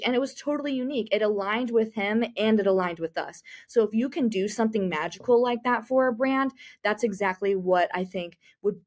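ordinary delivery

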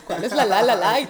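loud, laughing mock bafflement; half-laughing at her own confusion. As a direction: laugh through the question. Confused about milk labels, laughing.

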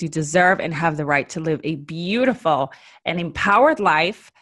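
usual speaking voice

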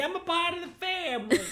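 high voice through a laugh; laughing mock mobster; Italian guy voice. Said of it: "my Italian guy bit".

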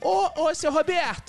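high, calling out to seu Roberto; beggar character. Pretend. Beggar hails Roberto, high caipira call. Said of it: "O mendiguei tem".

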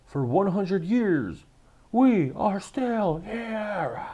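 high, loud, slow proclamation; Morpheus rallying speech. Retold or imitated. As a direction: declaim it to a vast crowd, stretching the last words. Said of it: "I remember Morpheus's speech".

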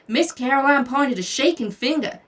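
usual speaking voice

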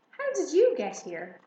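high, loud startled question; surprised. A narrator's reading. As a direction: caught off guard by the hare. Girl finds hare by bed.

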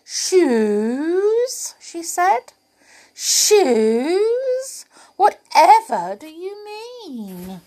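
very high, loud, innocent surprise; cheeky doll feigning shock at the shoes; surprised. Acted. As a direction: play the doll all wide-eyed and amazed. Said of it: "eyebrows shot up into her hair".

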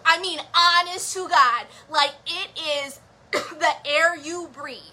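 usual speaking voice

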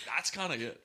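fuller Australian vowels on "kinda good"; Australian accent. Pretend. Repeating the line with a stronger Australian accent. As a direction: lean harder into the Aussie sound. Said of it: "You guys fucking nailed it".

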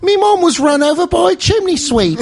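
very high British urchin voice; mock British accent. Host playing orphaned Brit, high British voice. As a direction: pitch it up as a British waif. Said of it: "do a British accent".

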